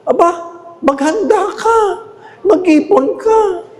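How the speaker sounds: high, slow, laughing warning; Bishop Sok imitated. Scene: priest quotes the bishop on saving for coffins, high and slow. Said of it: "ano sabi ni Bishop Sok".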